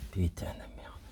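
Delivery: whispered swear, barely voiced; cursing under breath. Real, not acted. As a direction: curse quietly to yourself. Frustrated at part not fitting.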